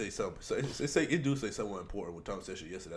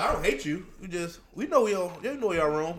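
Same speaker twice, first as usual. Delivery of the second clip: mock declaration; 6 semitones higher, 5 dB louder than usual. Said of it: "we like".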